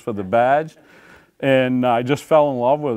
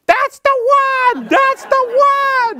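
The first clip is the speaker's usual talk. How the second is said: very high, louder shrieking woman; frantic lady yelling; 5 dB louder than usual. Retold or imitated. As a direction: screech it out the window, frantic.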